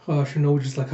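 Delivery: ordinary talk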